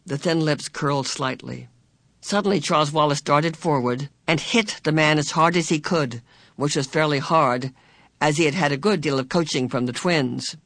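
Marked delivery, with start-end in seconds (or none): none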